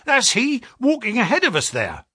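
high, eager exclamation; excited clerk spotting his man; excited cry. A narrator's reading. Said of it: "cried the clerk".

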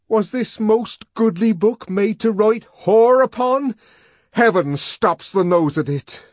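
high, loud ranting verse; the savage raving Shakespeare, high and loud. Acted. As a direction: hurl the lines like a madman. Savage rants Shakespeare in fury.

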